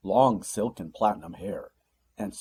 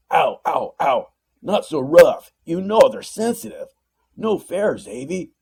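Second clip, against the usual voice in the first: much higher and louder whiny yelps; yelping in pain; 9 semitones higher, 12 dB louder than usual. A narrator's reading. Demon dragged out by the ear, high whiny yelps. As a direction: whine like a big baby being dragged off.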